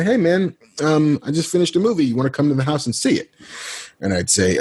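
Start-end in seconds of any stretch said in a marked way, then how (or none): 0.0-3.3 lightly imitated friend, higher pitch; casual invitation in a friend's higher voice; Kevin impression. Friend inviting him over for a movie, lightly imitated. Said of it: "The way you do his".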